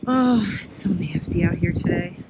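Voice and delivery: normal talking voice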